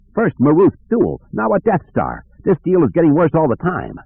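high, distressed smuggler complaining; whiny Lando. Acted. Lando griping at Han as the moon explodes, high and panicky.

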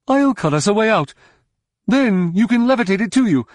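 high, pressing character voice; high-pitched, hurried call to an ally; urgent shouting. A narrator's reading. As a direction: shout the plan mid-fight. Droma shouting a plan in battle.

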